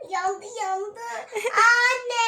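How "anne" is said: loud call to mum in the next room. Real, not acted. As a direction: shout for mum. Child calls mother urgently. (annesine yüksek sesle sesleniyor)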